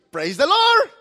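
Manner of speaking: high, loud joyful cry; praise shout. Acted. Pastor answers mockers with a high, loud praise.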